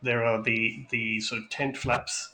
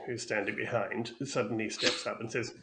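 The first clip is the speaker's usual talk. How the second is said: ordinary delivery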